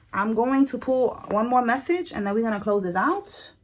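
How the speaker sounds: soft, quiet call to the Holy Spirit; hushed prayer. Acted.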